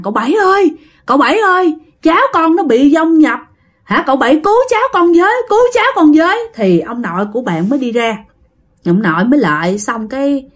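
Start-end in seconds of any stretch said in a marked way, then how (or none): 0.0-6.4 high, begging plea for help; panicked pleading. Retold or imitated. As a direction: beg the old man to save the child. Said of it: "cổ mới nói với ông nội rằng". (van xin hoảng loạn, giọng cao)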